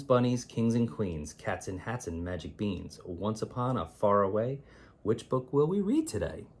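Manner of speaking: ordinary delivery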